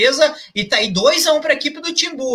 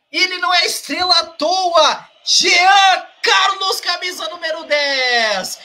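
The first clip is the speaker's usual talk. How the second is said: excited shout; 6 semitones higher, slower than usual. Real, not acted. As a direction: bellow the player's name proudly. Praising the goalscorer after the goal.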